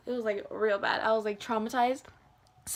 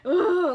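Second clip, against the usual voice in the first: high, loud "ooh"; loopy imitated hoot; silly noise; 6 semitones higher, 5 dB louder than usual. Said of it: "I was saying noises like that".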